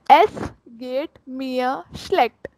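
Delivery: very slow, geht mir schlecht drawn out; very slow demo. Shown as a demonstration. Teacher repeating for learners, very slow. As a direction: stretch every word. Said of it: "Again, I am repeating".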